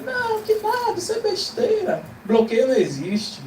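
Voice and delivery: very high-pitched mock denial; dismissive brain voice. The brain denies the emotional block, mock dismissive. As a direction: wave it off in a put-on high voice. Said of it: "Seu cérebro fala".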